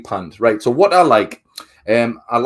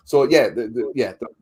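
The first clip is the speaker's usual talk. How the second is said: normal talking voice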